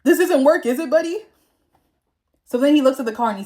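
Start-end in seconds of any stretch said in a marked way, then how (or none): 0.0-1.2 mild mock yell, higher and a little louder; raised, higher-pitched mock call-out; retold yell. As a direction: call out a taunt, half-strength. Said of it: "yells out to Jay".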